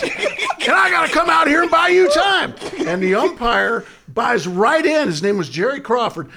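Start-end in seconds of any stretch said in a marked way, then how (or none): 0.0-2.5 high, loud, laughing through the line; manager's confession, broken by laughter. Retold or imitated. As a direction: crack up while quoting the manager. Manager confiding to umpire.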